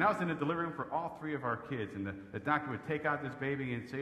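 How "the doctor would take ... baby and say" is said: normal talking voice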